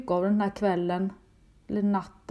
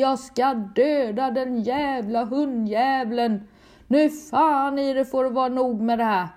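retold furious yell; 4 semitones higher, 3 dB louder than usual. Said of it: "och skriker".

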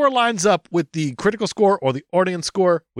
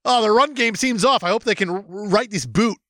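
sportscaster voice; 6 semitones higher than usual. Pretend. Mock commentary continues.

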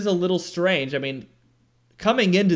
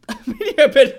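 laughing; 12 semitones higher, 10 dB louder than usual. Real, not acted. Laughing protest at teasing.

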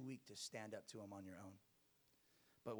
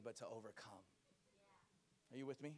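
ordinary talk

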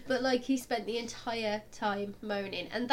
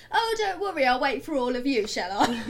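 loud sarcastic call to a crowd blocking the door; sarcastic shout; 3 semitones higher, 7 dB louder than usual. Retold or imitated. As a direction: shout it so everyone hears. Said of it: "at the top of my lungs just scream".

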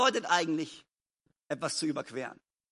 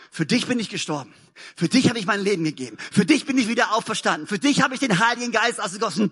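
ordinary talk

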